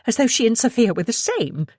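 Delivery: usual speaking voice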